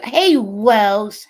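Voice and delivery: loud, high shout-out; excited greeting. Real, not acted. Streamer spotting a viewer in the chat, excited greeting. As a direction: holler hello across to a friend.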